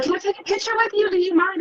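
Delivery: very high, quick, small-voiced asking; starstruck request. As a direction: squeak out a hurried fan request. Timid photo request, retold.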